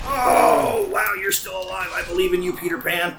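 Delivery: high loud thrilled cheer; excited cheering. Real, not acted. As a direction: cheer them on.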